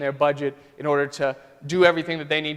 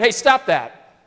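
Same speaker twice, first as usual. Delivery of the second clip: sharp raised yell to break up a fight; yelling stop; 6 semitones higher, 5 dB louder, slower than usual. Retold or imitated. New teacher shouting at brawling kids, a raised yell. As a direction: shout it across a chaotic classroom. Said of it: "he yells out".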